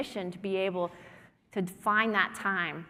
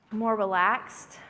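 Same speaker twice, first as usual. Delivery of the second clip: relaxed; much slower than usual. Real, not acted. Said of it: "relaxation after exercise".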